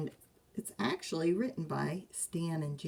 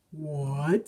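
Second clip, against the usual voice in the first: startled papa; 2 semitones lower, 5 dB louder than usual. Acted. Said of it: "said Papa".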